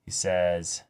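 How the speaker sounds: ordinary delivery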